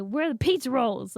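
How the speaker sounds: loud, high mock demand; teasing holler. Siblings tease brother at Thanksgiving, mock hollering. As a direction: call it out like a bratty kid.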